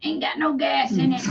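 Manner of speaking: lightly voiced drawling Carl; a hint of Sling Blade Carl. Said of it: "I do a good Carl from Sling Blade".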